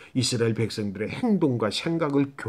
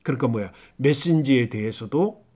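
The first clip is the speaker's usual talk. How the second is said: ordinary talk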